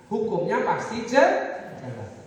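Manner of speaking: usual speaking voice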